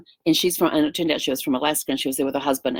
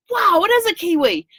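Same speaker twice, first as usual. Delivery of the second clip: amazed cowboy; 13 semitones higher, 4 dB louder than usual. Retold or imitated. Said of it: "turned around and goes".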